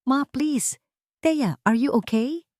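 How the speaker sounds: high, alarmed interruption; worried husband stepping in; urgent concern. Acted. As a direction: stop his mother and check on his wife.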